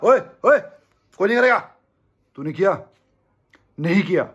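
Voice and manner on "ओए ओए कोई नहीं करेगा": loud, high call to stop the crowd; mock shout at fans. Player shouting at chanting fans to stop, loud mock shout. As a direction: yell it out like scolding a stand full of fans.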